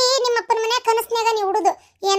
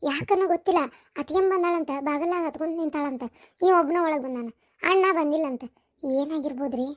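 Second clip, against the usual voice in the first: soft, lowered confiding voice; hushed worry; 4 semitones lower, 6 dB quieter than usual. Acted. Wife telling husband about the weeping sister-in-law, hushed worry. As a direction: confide it quietly and anxiously.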